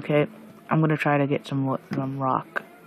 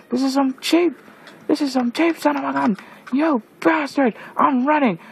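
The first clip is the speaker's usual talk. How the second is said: shouting in outrage while fleeing; angry yelling; 10 semitones higher than usual. Real, not acted. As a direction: rage at the mobs. Slime steals his stuff, raging.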